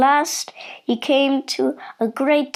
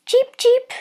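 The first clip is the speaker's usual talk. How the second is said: very high little peeps; duckling cheep; 12 semitones higher than usual. A narrator's reading. Big duckling looking out of its egg, high cheeping. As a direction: squeak like a baby bird. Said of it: "said the duckling".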